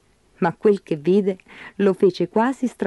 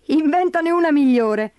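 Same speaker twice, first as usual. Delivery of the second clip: 1 laughing; 7 semitones higher than usual. Acted. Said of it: "rise scuotendo il leprecano".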